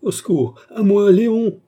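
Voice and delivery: high, loud scream for rescue; desperate cry for help. A narrator's reading. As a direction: cry out in terror, climbing to the name. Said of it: "Cria Cerise d'une voix mourante". (cri de détresse, aigu et fort)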